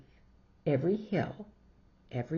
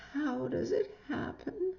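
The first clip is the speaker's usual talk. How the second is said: mock worried whimper. Jillian frets about mom.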